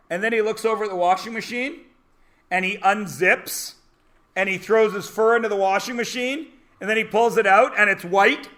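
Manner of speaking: normal talking voice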